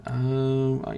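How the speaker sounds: ordinary talk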